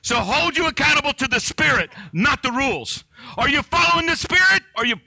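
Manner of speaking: high, loud climax of the sermon; a fervent preacher's cry; preaching at full force. Real, not acted. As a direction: drive the point home at the top of the voice.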